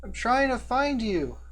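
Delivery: put-on high, searching boy's voice; young boy. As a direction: call out like a young boy who is lost. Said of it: "a familiar voice of a young boy".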